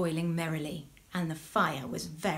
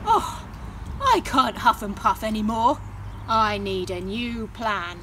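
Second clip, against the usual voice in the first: loud, exasperated wolf; worn-out wolf; 6 dB louder than usual. Acted. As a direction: wail like a spent wolf. Exhausted wolf.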